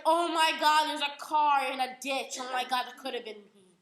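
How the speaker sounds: loud mock panic, easing off toward the end; alarmed. Girl spotting a crashed car in a ditch, mock alarm. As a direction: act out a shocked cry, hammed up.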